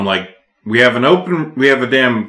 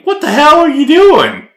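high, loud incredulous cry; yelling in disbelief at the boss; shocked; 5 dB louder than usual. Retold or imitated. Retold shock at coworker.

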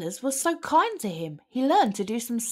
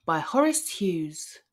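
usual speaking voice

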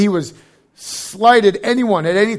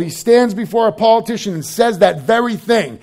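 ordinary talk

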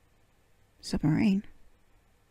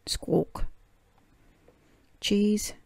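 1 parrot copying; 2 semitones higher than usual. A narrator's reading. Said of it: "Polly Parrot copied".

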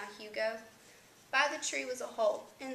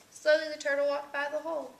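plain reading voice

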